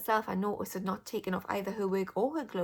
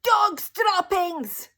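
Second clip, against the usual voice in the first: slow, high, loud screeching cry; witch's yell; 11 semitones higher, 12 dB louder, much slower than usual. A narrator's reading. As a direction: stretch it out like a sniffing witch. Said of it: "and yell".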